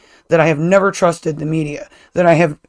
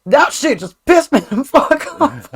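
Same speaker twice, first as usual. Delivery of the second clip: raised, louder mock rant that breaks into laughter; hammed-up angry tirade, amused by the end; imitating Savannah worked up; 4 semitones higher, 5 dB louder than usual. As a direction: retell her mad outburst, pitched up and loud. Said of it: "Savannah got all mad and worked up".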